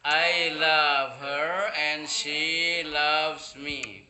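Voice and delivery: very slow, loud classroom English; projected dictation for learners; slow model sentence. Shown as a demonstration. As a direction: draw every word right out, easing off at the end.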